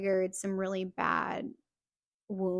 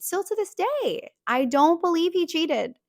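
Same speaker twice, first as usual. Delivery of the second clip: high, loud defiant protest; emphatic insistence; 7 semitones higher, 10 dB louder than usual. Real, not acted. Insisting to viewers she still won't believe it, loud and adamant. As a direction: voice lifts and pushes hard, standing your ground. Insists against what others will say.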